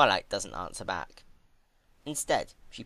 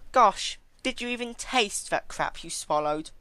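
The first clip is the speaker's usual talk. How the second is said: higher, louder, contemptuous scold; Twilight scolding; 13 semitones higher, 6 dB louder than usual. Acted. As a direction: sneer at her table manners.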